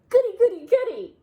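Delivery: high, loud delighted squeal; excited squeals. Acted. Bear squealing to Nora about the present. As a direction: squeal with joy at the news.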